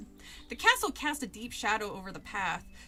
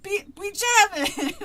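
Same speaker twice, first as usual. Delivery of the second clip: put-on Jamaican accent; 9 semitones higher, 10 dB louder, slower than usual. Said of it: "now I can't even fucking do Jamaican".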